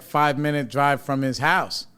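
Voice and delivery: normal talking voice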